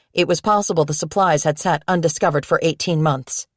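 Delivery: ordinary delivery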